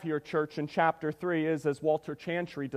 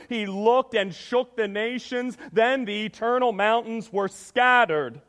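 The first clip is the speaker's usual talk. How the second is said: raised, loud, declamatory Bible reading; scripture reading; 4 semitones higher, 4 dB louder than usual. Acted. Preacher reads Habakkuk's prayer to the church, forceful scripture reading. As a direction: proclaim the prophet's prayer with force.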